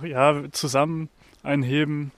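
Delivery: usual speaking voice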